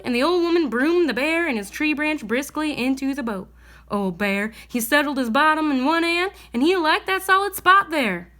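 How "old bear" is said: ordinary delivery, nothing marked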